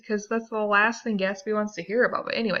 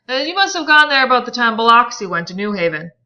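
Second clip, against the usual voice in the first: louder, fast, higher sneer; incredulous and insulting; 5 semitones higher, 10 dB louder, faster than usual. Acted. Tom insults Gatsby over Oxford, loud fast sneer. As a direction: sneer it out like a bully. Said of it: "Tom's voice incredulous and insulting".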